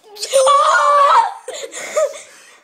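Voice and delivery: high screaming laughter; loud shrieking laugh. Real, not acted. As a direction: burst out laughing loudly. (yüksek kahkaha)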